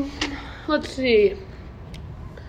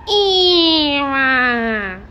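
EVE robot voice; 4 semitones higher, 5 dB louder than usual. Acted. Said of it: "That's Wally and Eva".

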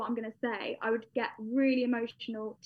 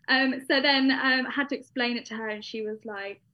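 normal talking voice

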